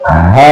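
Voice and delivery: हा low and breathy, the sound pushed from the chest; low chest हा demo. Shown as a demonstration. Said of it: "सीने से आवाज आनी चाहिए".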